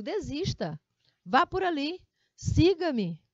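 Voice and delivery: loud, forceful order with exclamation; emphatic command. Shown as a demonstration. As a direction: bark it out like an order, high and loud. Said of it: "quando eu digo".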